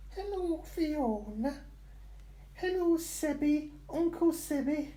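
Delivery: very high, slow squeaky voice for the dog. Acted. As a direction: speak for the dog in a tiny voice.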